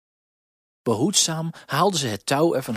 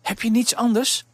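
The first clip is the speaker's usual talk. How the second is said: concerned question; 10 semitones higher, 4 dB louder than usual. Acted. Baker's wife questions the girl.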